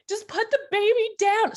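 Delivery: high, loud, overwhelmed cry; emotional plea. Retold or imitated. To her friend holding the baby, high tearful plea. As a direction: squeal it out through reunion tears.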